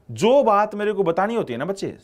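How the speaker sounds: normal talking voice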